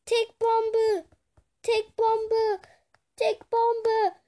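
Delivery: high, loud panicked shriek; shrieking alarm. Real, not acted. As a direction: scream the warning in panic. Warning of a ticking bomb.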